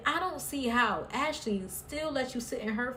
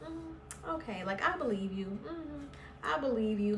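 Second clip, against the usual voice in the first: playing it off; 4 semitones higher, 6 dB quieter than usual. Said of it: "played it off like".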